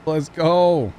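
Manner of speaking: high, loud and pumped up; excited cheer. Real, not acted. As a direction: cheer the donor on. Cheering a viewer's donation.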